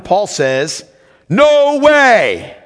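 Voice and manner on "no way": firm, louder no way; emphatic refusal. Retold or imitated. Preacher voicing Paul to the congregation, emphatic. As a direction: slam the door on the idea. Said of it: "He's very emphatic".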